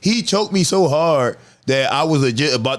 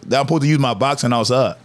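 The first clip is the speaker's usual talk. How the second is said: ordinary delivery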